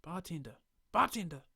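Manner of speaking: building from a call to a loud yell; yelling bartender. Acted. Stranger yelling across the bar for the bartender.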